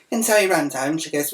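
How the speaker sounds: ordinary talk, nothing marked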